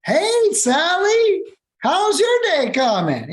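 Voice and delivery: very high, slow, sugary mock greeting; hammed-up sunny caller voice; chirpy cold caller. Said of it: "to just".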